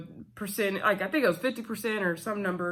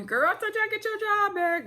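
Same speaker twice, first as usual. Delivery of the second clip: imitating August in a much higher squeaky voice; high mock boast; 13 semitones higher than usual. Recapping August's promise to Bridget, high mocking voice. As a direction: pitch way up, teasing her boast.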